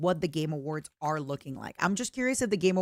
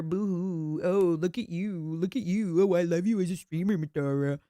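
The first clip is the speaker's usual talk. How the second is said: mock teasing voice. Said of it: "Oh, how nice".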